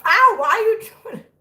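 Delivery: high, loud yelp into complaint; pained protest. Real, not acted. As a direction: protest to a biting kitten. Kitten is biting the finger.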